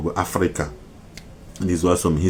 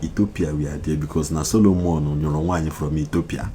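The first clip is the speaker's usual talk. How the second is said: ordinary talk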